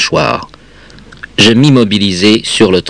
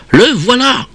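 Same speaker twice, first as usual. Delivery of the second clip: very high-pitched call of discovery; high acted street-kid cry. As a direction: call out to the gang on spotting your target. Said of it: "quelqu'un s'écria".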